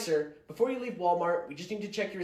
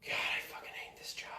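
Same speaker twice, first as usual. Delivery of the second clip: softer unvoiced whisper; whispered aside; 7 dB quieter than usual. Real, not acted. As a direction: whisper it under your breath. Worker mutters to self.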